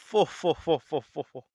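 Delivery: booming mock chuckle in repeated "fo"s; put-on "fo fo fo" laughter; imitated god's laugh. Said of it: "Zeus kinda just laughs and says".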